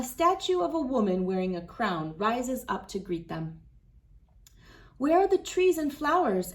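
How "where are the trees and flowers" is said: put-on child Rosita. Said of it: "asks Rosita".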